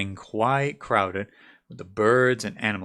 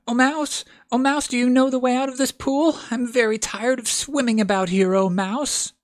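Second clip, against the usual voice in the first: high, loud, solemn calling out; lofty O Mouse call, pitched high; formal address to a mouse; 12 semitones higher, 5 dB louder than usual. A narrator's reading. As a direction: address the mouse grandly, like a herald. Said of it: "the right way of speaking to a mouse".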